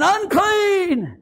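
very high, drawn-out cry; hollered warning. Acted. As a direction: holler it as lepers warning off passers-by. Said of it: "they're hollering".

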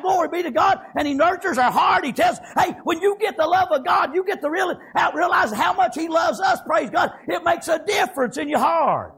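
ordinary delivery

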